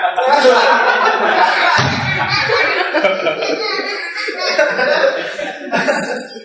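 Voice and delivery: high cackle in the granny voice; cackling granny laugh. Acted. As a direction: let out a shrill old-lady cackle. After grandma punchline.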